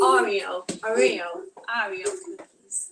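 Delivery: trying out an American accent. Shown as a demonstration. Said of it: "I'm gonna try and do an American accent here".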